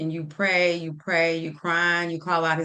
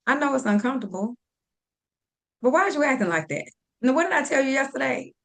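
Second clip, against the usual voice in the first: a hint of scolding a friend, a bit higher; firm no-nonsense girlfriend talk, mildly raised; tough love scolding; 5 semitones higher than usual. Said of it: "I feel like I have to give you that tough love".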